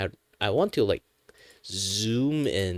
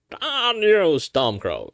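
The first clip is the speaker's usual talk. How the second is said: high strained thief voice; cursing the rebel, high and loud; horse thief voice; 11 semitones higher, 5 dB louder, much slower than usual. Acted. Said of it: "let's do the horse thief".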